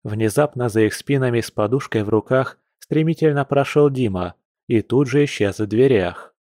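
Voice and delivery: usual speaking voice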